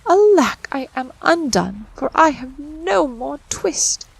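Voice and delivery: high, slow cry of despair; despairing lament. Acted. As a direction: wail that all is lost.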